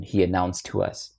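ordinary delivery, nothing marked

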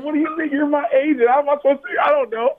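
high, panicked questions to his agent; frantic. Retold or imitated. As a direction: plead in disbelief.

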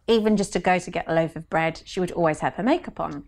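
usual speaking voice

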